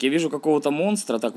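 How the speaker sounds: ordinary talk, nothing marked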